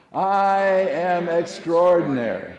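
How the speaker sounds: loud, high, slow proclamation; like you mean it. Shown as a demonstration. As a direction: belt it out with full conviction. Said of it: "say it like you mean it".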